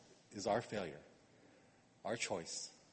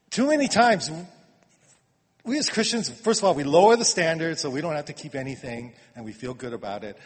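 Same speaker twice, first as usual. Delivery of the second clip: preaching at full force; 8 dB louder than usual. Acted.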